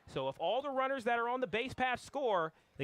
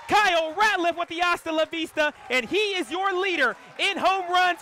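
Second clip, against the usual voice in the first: screaming, much higher and louder, calling the ball out of the park; ecstatic home run call; 9 semitones higher, 11 dB louder than usual. Real, not acted. Announcer calling a walk-off grand slam for the home crowd, screaming with joy. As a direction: belt it like the ball is leaving the stadium.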